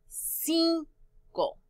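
"cinco" split into a high cin, then co; syllable by syllable. Shown as a demonstration. Teacher introduces the next word, "cinco" split in two. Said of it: "Cuántas sílabas en cinco".

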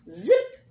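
high, loud zip; zip sound effect. Acted. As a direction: snap out a quick zip. Tongue zipping into hole.

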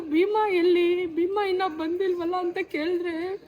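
crying. Real, not acted. Rider recalls people asking about her bike, crying. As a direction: voice cracking with tears as she speaks. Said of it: "ಆನಂದ ಬಾಷ್ಪ ಹುಡುಗಿಗೆ".

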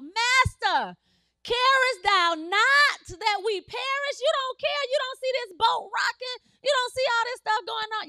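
high, urgent protest; crying out. Acted. Disciples in a rocking boat crying out to Jesus, high and alarmed. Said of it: "They cried out".

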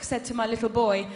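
ordinary talk, nothing marked

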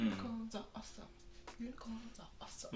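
whispered. Acted. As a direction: whisper it so only the mic hears. Repeating phrase to friend in headphones.

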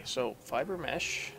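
ordinary talk

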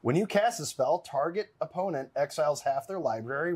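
ordinary talk, nothing marked

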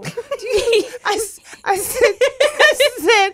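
helpless high, loud laughter breaking up the words; too tickled to finish; laughing. Real, not acted. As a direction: stumble and restart through real laughter. Cracking up at her own story.

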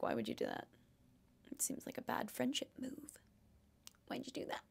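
playful whisper of mock disapproval; whispered tease. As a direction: hiss it softly like a scandalised friend. Teasing mock scolding aside.

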